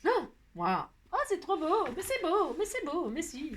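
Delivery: high-pitched cooing admiration; gushing delight. Real, not acted. Crafter admires a fresh butterfly print, gushing in delight. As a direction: marvel in a high, lilting voice.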